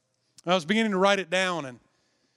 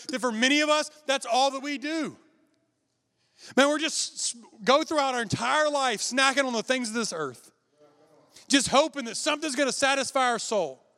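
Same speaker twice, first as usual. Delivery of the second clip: raised, full-force preacher voice; preaching; 6 semitones higher than usual. Acted. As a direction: drive it home to the congregation. Preaching to the church.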